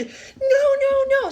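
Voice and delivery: high, loud bawled refusal; hammed-up squealing protest; mock child tantrum. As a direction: wail it like a stubborn little boy. Child back to his tantrum.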